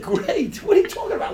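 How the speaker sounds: high, half-laughing disbelief; loud, laughing "great?!"; incredulous retort. Retold or imitated. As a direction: scoff at him in amazement. Retorting to the man's reply.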